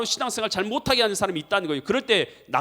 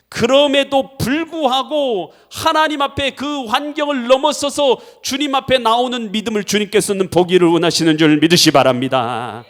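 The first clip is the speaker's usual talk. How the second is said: full-force preaching; 6 semitones higher, 10 dB louder than usual. Real, not acted. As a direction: drive the exhortation home at full volume. (힘차게 외치는 설교)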